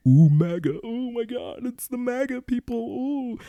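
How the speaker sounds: high, breathy mock gasp of horror; mock outrage. Mocking offended Twitter users, high squealing fake horror. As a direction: parody the pearl-clutching crowd in a high squeal.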